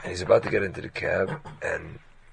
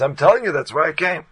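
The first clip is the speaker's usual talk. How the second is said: raised, higher insisting voice; stubborn loud insistence; insistent retort; 7 semitones higher, 8 dB louder than usual. Retold or imitated. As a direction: stand your ground, louder. Heated argument over the button.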